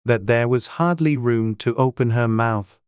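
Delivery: plain reading voice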